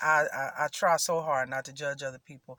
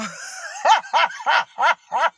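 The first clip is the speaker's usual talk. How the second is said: loud, high, full-bodied cackle; hearty laugh; 9 semitones higher, 8 dB louder than usual. Real, not acted. Vlogger cracking up at gossip, a loud hearty laugh. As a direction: let the big laugh roll out. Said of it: "let out a hearty".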